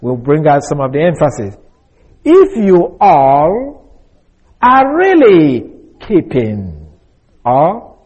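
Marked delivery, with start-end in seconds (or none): 2.2-6.9 "If you all are really keeping" drawn out slowly, stress on "all"; slow, weighty rendering of "If you all are really keeping"; slow emphatic reading. Shown as a demonstration. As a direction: read "you all" slowly and deliberately, leaning on each word. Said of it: "If we can translate it this way".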